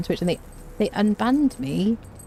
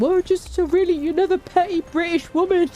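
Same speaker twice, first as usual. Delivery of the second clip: much higher, louder sneering quote; mocking the troll; 10 semitones higher, 3 dB louder than usual. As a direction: parrot his insult with scorn. Quoting the troll's insult.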